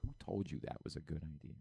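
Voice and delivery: low, soft deflated reaction; dismayed disbelief. Acted. Host reacting to fake broadcaster voice, quietly appalled. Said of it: "and you're like".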